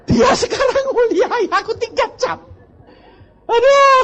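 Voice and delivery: high and loud through laughter; loud laughter over the words; laughing. Real, not acted. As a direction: crack up at the irony. Laughs at the irony.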